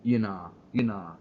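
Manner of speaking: slow, sneering "You nah, you nah"; lightly mocking "you nah"; mocking youth slang. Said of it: "start speaking like that".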